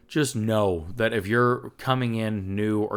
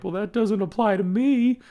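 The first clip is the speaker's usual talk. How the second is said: pitched way up, whiny and defensive; mocking defensive quote; 13 semitones higher, 5 dB louder than usual. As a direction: scoff it off like a sulky addict. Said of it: "just be like".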